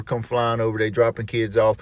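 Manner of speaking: ordinary talk, nothing marked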